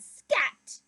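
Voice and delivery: duck shooing. Acted. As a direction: shoo it off as the duck. Duck shoos the bee.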